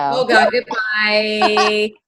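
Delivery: loud, exclaimed sign-off; mock-horrified goodbye. Real, not acted. Colleague hears boyfriend has no pants on, mock-horrified goodbye. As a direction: cut the call off loudly in mock horror.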